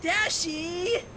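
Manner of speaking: high, wounded call of a name; hurt cry. Acted. Pinkie hurt by Dash's insult, wounded cry. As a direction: sound stung by a friend. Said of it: "The hurt was evident in Pinkie's voice".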